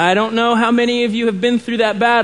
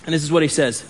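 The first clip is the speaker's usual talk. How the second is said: ordinary delivery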